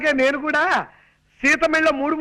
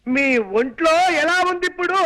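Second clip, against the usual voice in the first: loud, audible question; 2 semitones higher than usual. Acted. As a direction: project every word so she hears. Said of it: "అంత గట్టిగా అరవకు నాయనా".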